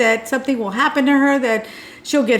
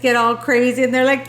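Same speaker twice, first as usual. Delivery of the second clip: usual speaking voice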